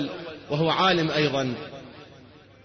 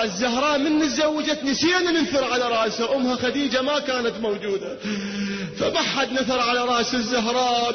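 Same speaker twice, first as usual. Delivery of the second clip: higher, fervent sermon storytelling; impassioned preaching; 6 semitones higher than usual. Acted. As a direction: preach the story with rising fervour. (خطابة حماسية بصوت مرتفع)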